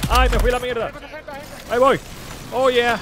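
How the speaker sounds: high loud excited yells; excited shouting. Real, not acted. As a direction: hype yelling mid-fight. Wild drop into fight.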